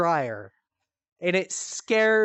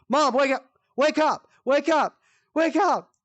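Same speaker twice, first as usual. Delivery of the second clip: higher, louder urgent yelling; yelling to wake her; 9 semitones higher, 5 dB louder than usual. Retold or imitated. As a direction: shout her awake, over and over. Shaking sleepwalking mom awake.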